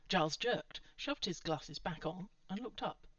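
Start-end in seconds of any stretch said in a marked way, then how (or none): none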